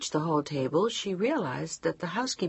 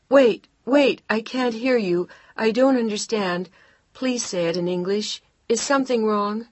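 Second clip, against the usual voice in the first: louder, higher calling down a bad line; raised voice on phone; 5 semitones higher, 5 dB louder than usual. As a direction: push the voice to be heard far away. Straining over bad phone line.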